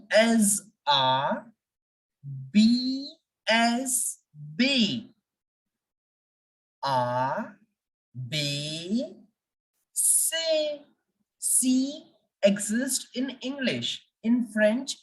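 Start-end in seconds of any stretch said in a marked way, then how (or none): 2.5-3.1 B drawn out as "bay"; a slow French "bay" for B; French letter B. Shown as a demonstration. Teacher showing how French says B, drawn-out "bay". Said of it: "We will say". 6.8-10.4 A, B, C said "ah, bay, say"; French letters one by one, with pauses; French alphabet. Shown as a demonstration. Teacher modelling French letters for the class, A, B, C as "ah, bay, say". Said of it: "right pronunciation of these French".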